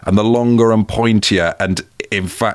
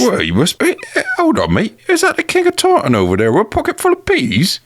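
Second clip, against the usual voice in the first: much higher, fast hammed-up West Country farmer; yokel accent. Co-host joins the bit as a pea farmer, hammed-up yokel accent. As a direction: squeak it out like a gossiping villager. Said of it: "they've all got a similar point of view".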